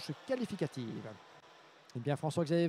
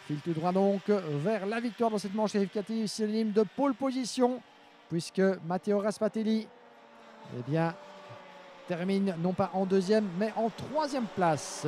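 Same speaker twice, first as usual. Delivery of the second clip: higher and louder winning call, slowing at the end; motorsport commentator; 5 semitones higher, 4 dB louder than usual. Real, not acted. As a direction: ring out the result, stretch the last words. Announcing win at the line.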